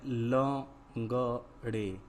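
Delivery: लंगड़े split into slow separate syllables; लंगड़े drawn out, every syllable held apart. Shown as a demonstration. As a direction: sound out लंगड़े piece by piece. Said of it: "क्या है".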